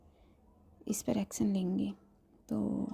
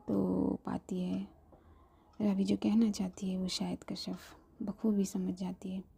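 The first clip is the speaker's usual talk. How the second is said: plain reading voice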